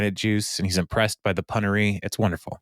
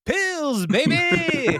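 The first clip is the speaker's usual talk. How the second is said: squeaky high mock voice; much slower than usual. Retold Leslie quote, squealed.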